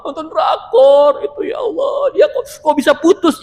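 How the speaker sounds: high, wailing drama-fan voice; hammed-up tearful woman upset over a TV breakup; mock crying. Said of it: "Nonton drakor aja dia nangis".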